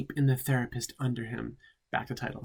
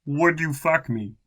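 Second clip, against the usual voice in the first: flat character read, again; 4 semitones higher, 4 dB louder than usual. Acted. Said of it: "No question mark".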